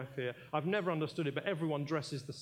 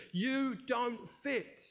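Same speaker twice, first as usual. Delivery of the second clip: drawn out; 7 semitones higher, much slower than usual. Acted. Climax of accusing voice.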